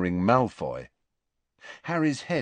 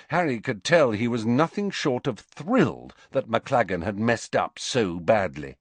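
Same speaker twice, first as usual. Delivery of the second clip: plain reading voice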